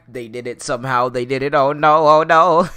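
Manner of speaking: lightly acting out someone's sinking realisation; imagined dismay. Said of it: "he probably's like".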